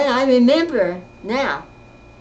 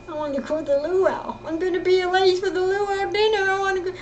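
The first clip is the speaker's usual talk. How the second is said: higher, insistent plea to go to the party; griping like a confused child who wants to go; upset pleading; 7 semitones higher than usual. Retold or imitated. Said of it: "she thought I was real upset".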